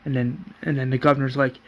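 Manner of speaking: usual speaking voice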